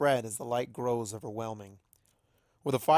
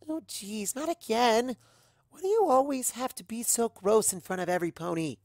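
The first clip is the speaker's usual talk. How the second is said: much higher, exasperated daughter; whiny teen; 10 semitones higher than usual. Acted. Daughter complaining to her parents, high and whiny. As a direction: groan at embarrassing parents.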